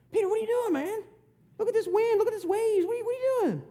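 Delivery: high-pitched mock alarm; alarmed disciple. Disciples questioning Peter out on the water, high alarmed voice. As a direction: pitch it way up, fretting in disbelief.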